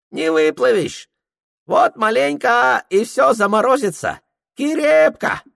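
put-on high, broken-Russian old Tungus voice; Tungus guide. Tungus greeting Prokhor in a high character voice.

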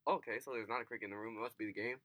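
low, soft, quick aside; quiet reasoning. Retold or imitated. Two roommates puzzling over a noise, hushed and low. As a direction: reason it out quietly, half to yourself.

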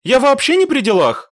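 high, insistent denial; innocent protest. A narrator's reading. Mashka denies blame to her commander, innocent protest.

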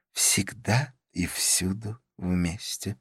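slow, quiet woman's line; softly voiced Karla. A narrator's reading. Karla answers that they go everywhere together, slow and soft. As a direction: say it slowly and softly, letting each word hang.

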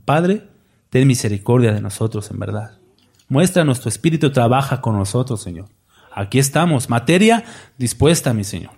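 hushed opening prayer. Real, not acted.

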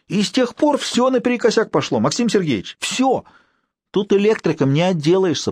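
put-on an anxious old man explaining himself; old caretaker. A narrator's reading.

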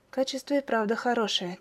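usual speaking voice

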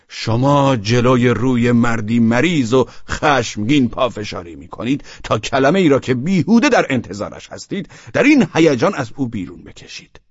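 shouting at a stubborn guest. A narrator's reading. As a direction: yell it at him, furious. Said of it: "فریاد کشید".

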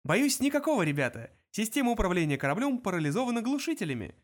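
high, chirpy explanation; cheerful computer. A narrator's reading. Computer reports jammed controls to the crew, chirpily cheerful. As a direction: break bad news brightly, pitched high. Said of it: "бодро объяснил компьютер".

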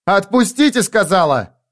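high, sharp order; insistent demand. A narrator's reading. Held girl ordering the men to let go, angry insistence. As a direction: raise the pitch and insist.

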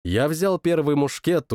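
ordinary delivery, nothing marked

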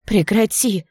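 shaky, loud plea; trembling voice. Acted. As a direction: beg him to stop, voice trembling. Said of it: "дрожащим голосом попросила я".

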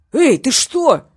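sharp, loud, high alarmed shout. A narrator's reading. Gang boss on the phone, suddenly alarmed. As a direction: jolt in panic at the threat. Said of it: "обеспокоилась машина".

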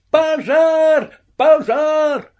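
high, wild, exultant cries; mad joyful shouting. A narrator's reading. Boy smashing lamp after lamp, shouting with mad joy. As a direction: shout the alarm with crazy glee. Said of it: "с безумной радостью кричал я".

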